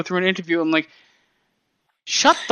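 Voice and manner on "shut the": high-pitched retold outburst; exasperated mock shout. Podcaster telling off a wrestler mid-interview, exasperated mock shout. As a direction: burst out as if cutting someone off.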